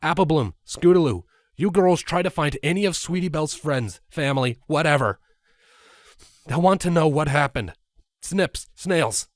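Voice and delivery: put-on rally the kids fast; urgent orders.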